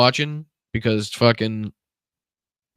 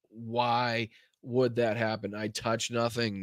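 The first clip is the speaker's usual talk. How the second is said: softer, very slow, resigned repeat; deflated murmur; 8 dB quieter, slower than usual. Real, not acted. Streamer repeating to chat, softer and slowly deflated. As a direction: let it sink out of you, defeated. Same question said again.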